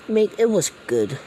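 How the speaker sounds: high and loud mock praise; Australian gang boss. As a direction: sneer the compliment. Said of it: "this game's Australian".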